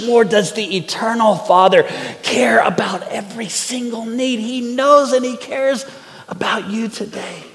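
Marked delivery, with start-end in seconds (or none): 2.2-4.4 breathy whisper to the congregation; soft whispered assurance. Real, not acted. Intimate emphasis on God's care. 4.4-7.4 tender assurance. Real, not acted.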